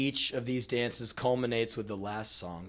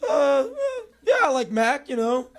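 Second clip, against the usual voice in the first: high, loud, hesitant fake enthusiasm; feigned agreement; 13 semitones higher, 6 dB louder than usual. Boy pretending to share her music taste, overeager. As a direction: agree too eagerly, stumbling in.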